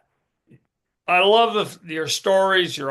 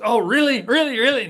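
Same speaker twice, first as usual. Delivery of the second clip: much higher, eager repeated questions; excited kids; 9 semitones higher, 4 dB louder, faster than usual. Retold or imitated. Children fooled by dad about no school, excited and high. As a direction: bounce like delighted children.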